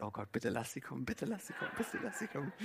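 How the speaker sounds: soft, fast, pleading under the breath; a nervous best man's inner plea, hushed and rushed; silent desperate prayer. Retold or imitated. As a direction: pray it hurriedly to yourself, almost inaudible. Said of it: "in deinem Herzen betest du".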